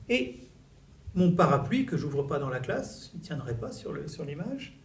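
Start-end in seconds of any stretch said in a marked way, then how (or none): none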